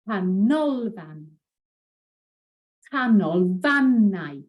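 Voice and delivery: canolfan slow with stress on "nol", canolfannau with stress on "fan"; penultimate stress. Shown as a demonstration. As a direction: move the stress along as the word grows. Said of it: "stress usually falls on the last but one".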